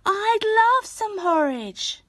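put-on hungry girl accepting the woman's porridge; eager little girl. A narrator's reading. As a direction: sound delighted at the offer. Hannah answers the woman.

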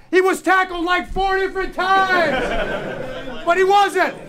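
high, loud amazed shout; excited. Real, not acted. Coach hyping up the team about a tackled run, excited. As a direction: shout it with disbelief and joy.

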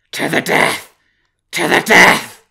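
howled, high and very loud; wolf howl. Acted. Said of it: "howled the wolf".

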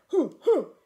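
loud high ape hoots; ape hooting. Acted. Cave man approaching the boys, hooting. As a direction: hoot like a cautious ape man. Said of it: "making noises that sound like".

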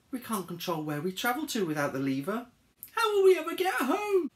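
rising from fretful to shrieking; panicked wail. Acted. Harold fears being stranded, mounting panic. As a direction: build to a high, loud wail. Harold despairs of getting home.

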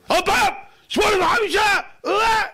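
very high, loud furious shouts; explosive acted tirade; boss yelling. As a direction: blast the latecomer at full voice. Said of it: "הוא צועק".

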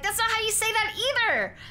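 imitating outraged viewers; chat protesting. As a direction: squeak out the chat's objection in disbelief. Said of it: "And everyone was like".

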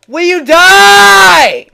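high, long-held furious scream; too pissed. Real, not acted. Gamer screaming at an enemy who won't die, enraged. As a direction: scream it, dragging out the last word. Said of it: "I'm getting too pissed".

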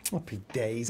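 low, soft happy days; muted remark. Real, not acted. Reading the chat to themselves, low and muted. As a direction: say it under the breath, low. Same words, muted then exclaimed.

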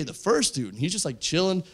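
usual speaking voice

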